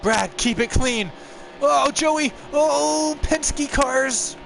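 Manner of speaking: very high, loud falsetto reacting to the wreck; a squeaky hammed-up driver panicking; falsetto race voice.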